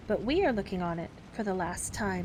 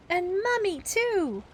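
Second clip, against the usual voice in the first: eager; 11 semitones higher, 3 dB louder, slower than usual. Acted. Children shout eagerly.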